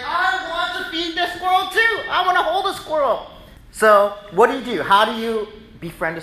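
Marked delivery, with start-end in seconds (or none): 0.0-3.3 put-on high eager kid voice; pleading like a little kid; much higher, whiny child wanting a squirrel; childish wish. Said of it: "as a kid, I'd be like".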